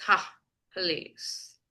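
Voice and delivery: low, drawn-out sarcastic please; scoff, then stretch out a mock please. Shown as a demonstration. Teacher showing how please can be sarcastic, sarcastic. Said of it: "you can be sarcastic like".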